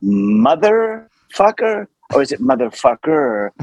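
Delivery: "motherfucker" sounded out slowly, each half stretched, the final er carried; drawn-out er. Retold or imitated. Naive actor asks how to pronounce a curse, drawn-out er. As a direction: try "motherfucker" two ways, dragging out the er. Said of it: "and you carry the er".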